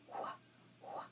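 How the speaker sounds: "wh" as a short puff of sound; isolated wh sound. Shown as a demonstration. Teacher quizzes pupils on spelling a sound, isolated wh. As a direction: sound out "wh", not the letter names.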